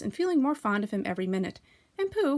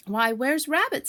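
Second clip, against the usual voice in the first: higher, slower motherly kangaroo; Kanga's voice; 7 semitones higher, 4 dB louder, slower than usual. A narrator's reading. Kanga looking round for Rabbit, higher and puzzled.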